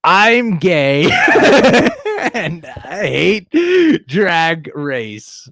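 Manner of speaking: reading it out while laughing, slowed; can't get the words out for laughing; laughing through it. Real, not acted. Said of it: "laughs while reading".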